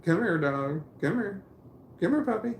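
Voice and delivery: calling the puppy in a high, bright sing-song; high dog-calling voice. Real, not acted. Man calling his puppy over, high and coaxing.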